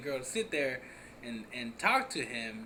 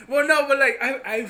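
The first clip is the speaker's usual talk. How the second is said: laughing hard, high and loud; can't get words out for laughing; 11 semitones higher, 14 dB louder than usual. Real, not acted. Laughing through her words.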